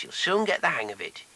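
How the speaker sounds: ordinary talk, nothing marked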